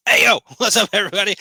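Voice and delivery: loud, high hype greeting; excited greeting. Real, not acted. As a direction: burst in with big energy. Energetic vlog greeting.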